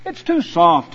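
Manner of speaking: usual speaking voice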